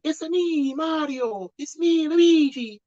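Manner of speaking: high, hammed-up Italian plumber voice, mocking the movie's take; over-the-top Italian accent as a parody of the film. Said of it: "they put on the, the Italian accents".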